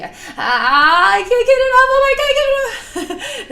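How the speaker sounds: high, loud panicked cry with a nervous laugh; half-laughing panicked wail; mock panic. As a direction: squeal it like you're freaking out. Acting out panic over sticky goo.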